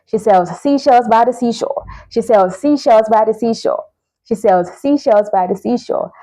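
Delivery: brisk, run-together tongue twister; repeated drill. Shown as a demonstration. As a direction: rattle off the twister over and over. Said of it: "The more you say it".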